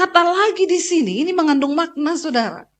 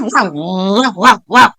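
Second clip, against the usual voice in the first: mock nagging; 7 semitones lower, 4 dB louder, much slower than usual. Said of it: "Suaminya baru mah".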